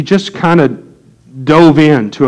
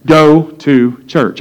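slow, deliberate echo; much slower than usual. Retold or imitated. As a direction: GO TO CHURCH spaced out word by word. Slow echo underlining the phrase.

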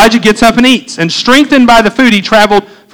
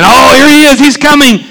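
higher-pitched eager exclamation; acted excited anticipation; 5 semitones higher, 3 dB louder than usual. Elijah thinks God is coming in the wind, excited. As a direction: voice Elijah's rising hope.

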